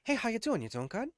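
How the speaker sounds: high, soft put-on celebrity voice; mock movie-star chit-chat; Mark Wahlberg. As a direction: imitate the actor's casual greeting in a high voice. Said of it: "Mark Wahlberg is like".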